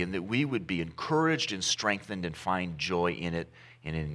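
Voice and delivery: hushed pastoral prayer. Real, not acted. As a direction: pray it quietly and humbly. Pastor prays before the sermon.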